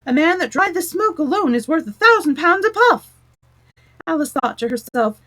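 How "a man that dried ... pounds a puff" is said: much higher, louder chanting crowd; chorus of voices. A narrator's reading. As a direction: lift it into a loud group chant. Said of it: "the chorus of voices went on with".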